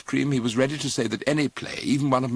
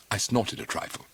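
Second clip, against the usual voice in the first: ordinary delivery, nothing marked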